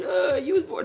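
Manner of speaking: high childish mock comeback; lightly voiced kid retort; mocking kid's comeback. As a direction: play the kid fumbling a reply. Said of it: "what they gonna say".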